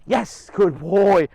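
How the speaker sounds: drawn-out praise. Real, not acted. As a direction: stretch out the praise for the dog. Praising dog after command.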